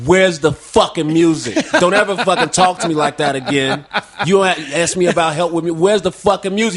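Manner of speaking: loud, heated retelling with laughs behind; forceful, indignant rant; angry confrontation. Retold or imitated. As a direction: act out telling someone off, loudly. Retelling angry confrontation outside barbershop.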